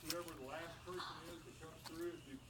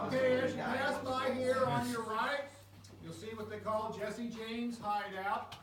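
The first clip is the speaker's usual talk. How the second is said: echoing cave guide; 7 semitones higher, 10 dB louder, slower than usual. Real, not acted.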